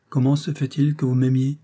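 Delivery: plain reading voice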